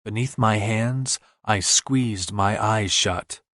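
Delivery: ordinary delivery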